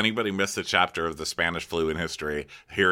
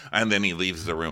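ordinary delivery

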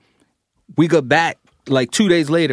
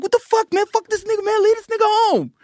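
mocking angry crew. Junior Mafia angry at airport.

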